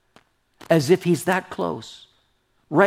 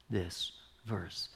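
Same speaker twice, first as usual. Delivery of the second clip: slow, breathy whisper of awe; 6 semitones lower, 14 dB quieter than usual. Real, not acted. Pastor confiding his love of the verse, whispered slowly.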